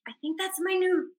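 quiet, unsure murmur to herself; half-whispered guess; hushed. Retold or imitated. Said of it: "I was standing outside the stage door going".

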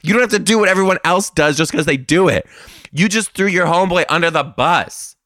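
high, loud scolding of the whole class; shrill-free but loud mock outrage at the kids; indignant rant. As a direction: lecture them like a betrayed teacher. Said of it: "I'm over here like".

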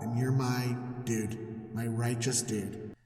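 low, soft and sneaky; God coaxing Abram into the covenant; sly hushed God. Retold or imitated. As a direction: slip in the catch quietly. God slyly slipping in circumcision.

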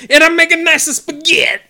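high, loud hammed-up bad Italian accent. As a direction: ham up a cartoon Italian accent. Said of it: "an Italian accent".

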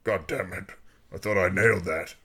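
low-key mock grumble; retold muttered anger. Worf mad at the cast list, understated grumble. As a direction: grumble it softly, a touch of anger. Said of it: "mad when she puts up the cast list".

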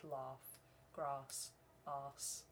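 "laugh", "grass", "arse" all on a long "ah"; southern long A. Shown as a demonstration. Showing how southerners say those A's, "laugh", "grass", "arse" with long A. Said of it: "That's the way they sort of say those".

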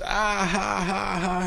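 high-pitched mock guffaw, spelled out; imitated dying laughing. Manager laughing at his caught players, mock hysterics. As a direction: imitate someone cracking up, high ha-ha-ha. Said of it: "he just starts dying laughing".

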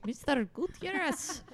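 put-on high, proud kid voice; little boy voice. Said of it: "My teacher".

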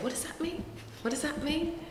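high, puzzled repeated question; high confused asking, quoting yourself. Retold or imitated. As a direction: echo your younger self, baffled by the slang. Asking cousins about new slang.

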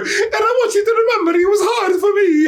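laughing shouted mock of the octopus; mock whine. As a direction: whine it like a self-pitying octopus. Mocking octopus whining loudly.

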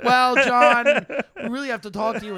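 high, loud sweet coaxing; overly polite cop. Retold or imitated. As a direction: coax him ever so nicely. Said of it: "they're being so nice".

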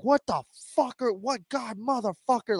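hammed-up radio rant at the crew; mock angry outburst. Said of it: "and Kevin was like".

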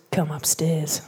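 imitating a hushed whisper; low secretive whisper, half joking; whispered invite. Man luring her upstairs, imitated in a whisper.

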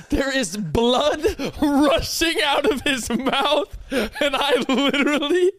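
laughing through the story; can barely get the words out for laughing. Real, not acted. Friend laughing while recalling the bloody mouth.

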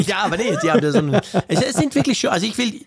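durch Lachen hindurch reden; lachend. Real, not acted. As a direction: lachend weitererzählen. Lacht über teure Kabel. (lachend gesagt)

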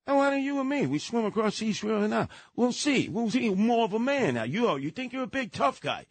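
mock him daring you to swim the river; taunting dare. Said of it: "he looks at me and he goes".

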